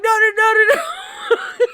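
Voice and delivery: high, loud no's breaking into laughter; laughing protest. Retold or imitated. Canary refusing while cracking up, laughing.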